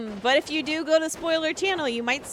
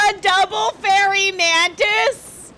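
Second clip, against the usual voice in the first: disbelieving; 6 semitones higher, 5 dB louder than usual. Real, not acted. Disbelief at drop.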